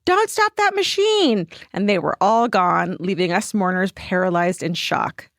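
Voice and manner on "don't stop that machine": high, drawn-out mock yell; pitched-up urgent yell, held back in loudness; narrated yell. A narrator's reading. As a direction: cry it out high and slow, without full volume. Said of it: "his wife yelled".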